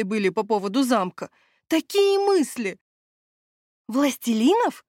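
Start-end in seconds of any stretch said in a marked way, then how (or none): 1.7-2.8 much higher and slow, savouring it; dreamy exclamation. Acted. Steward lamenting his lost castle plans, dreamily high and slow. 3.9-4.8 exclaiming. Acted. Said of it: "хором воскликнули друзья".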